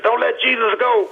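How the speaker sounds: high strained cry of the plea; screaming. Retold or imitated. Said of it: "I started screaming".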